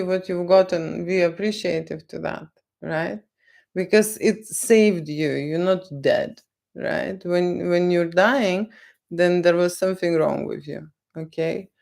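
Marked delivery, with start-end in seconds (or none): none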